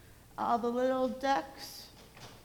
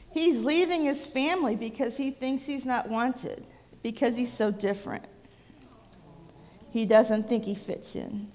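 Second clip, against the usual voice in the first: plain reading voice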